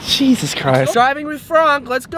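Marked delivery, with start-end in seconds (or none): 0.9-1.9 bright show-intro announcement; announcer voice. Acted. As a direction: call out the segment title like a host. Announcing show segment title.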